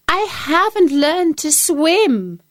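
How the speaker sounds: high, slow cat voice. A narrator's reading. Cat stopping the dog at the river, high and slow.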